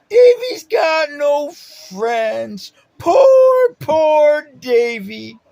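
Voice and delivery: high, loud, slow fake sobbing; mock crybaby whining. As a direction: mimic a sneering elder mocking a crybaby. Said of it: "that's how he acted".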